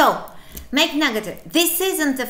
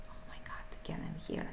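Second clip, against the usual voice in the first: whispered aside to herself; hushed surprised aside; 17 dB quieter, much faster than usual. Real, not acted. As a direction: whisper it, half to yourself.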